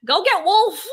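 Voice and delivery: mock yell. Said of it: "he was like".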